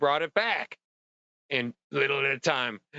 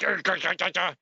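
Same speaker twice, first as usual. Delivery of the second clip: higher-pitched wordless grumbling; mock ranting noises; 5 semitones higher, faster than usual. Worn-out helper venting over the job, mock ranting growls. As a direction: sputter out frustration as nonsense barks. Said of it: "So I rant and vent".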